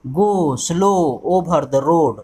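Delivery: drawn-out o sounds so students hear the repetition; vowel sound stressed. Shown as a demonstration. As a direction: stretch every "o" in "Go slow over the road", slowly. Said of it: "ओ vowel जो है ओ".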